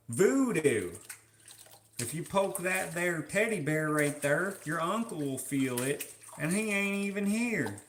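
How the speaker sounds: put-on New Orleans twang; New Orleans accent. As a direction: spin folksy lore in a mock New Orleans accent. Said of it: "New Orleans?".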